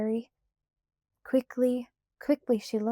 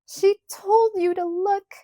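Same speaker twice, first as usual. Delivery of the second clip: put-on high, loud, frantic child's scream; 10 semitones higher, 6 dB louder than usual. A narrator's reading. Carrie argues back at Laura, screaming. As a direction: scream it in accusation. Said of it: "Carrie screamed".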